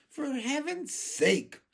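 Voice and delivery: high, drawn-out exasperated outburst. A narrator's reading. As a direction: burst out in fed-up protest. Exasperated earthworm objects.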